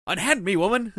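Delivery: high, loud theatrical knightly outcry; hammy stage actor. Retold or imitated. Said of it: "he'd be like".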